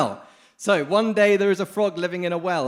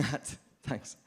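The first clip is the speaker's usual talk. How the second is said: laughing; 8 dB quieter than usual. Real, not acted. Laughs mid-sentence, restarts.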